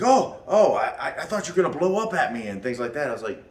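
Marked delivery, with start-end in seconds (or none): 0.0-2.5 mock frightened. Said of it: "He's like".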